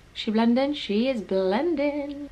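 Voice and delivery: slow, soft purr; satisfied purr. Acted. Admiring her concealer, slow soft purr. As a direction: savour it smugly. Pleased with blending.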